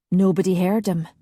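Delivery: usual speaking voice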